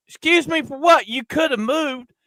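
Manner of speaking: high, snippy voice of the rude lady; mocking rude woman. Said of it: "And she's like".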